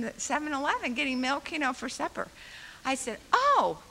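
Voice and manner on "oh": high startled gasp of dismay. Retold or imitated. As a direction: caught off guard, gasp it. Caught out in the store.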